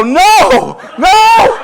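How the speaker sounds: very high, very loud drawn-out wail; horrified yell. Acted. Dad just ripped daughter into pool, horrified yell. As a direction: cry out in hammed-up regret. Dad's instant regret after pulling her in.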